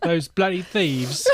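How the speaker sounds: drawn-out mock British accent. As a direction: play up a British accent, slowly. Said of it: "what a British thing".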